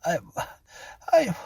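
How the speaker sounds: breathless broken stammer; out of breath. A narrator's reading. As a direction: gasp for the words to answer. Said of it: "she could not find the breath to answer".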